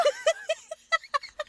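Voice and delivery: high, loud laughing fit; real laughter breaking out; burst of laughter. Real, not acted. Laughing again. (lautes Loslachen)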